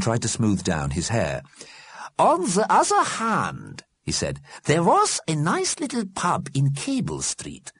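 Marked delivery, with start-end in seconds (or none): 2.2-3.8 put-on high, slow, accented character voice; mild-mannered Einstein figure. A narrator's reading. Einstein answers Fletcher, high and unhurried. 4.6-7.7 put-on high, slow, accented character voice; mild-mannered Einstein figure. A narrator's reading. Einstein answers Fletcher, high and unhurried.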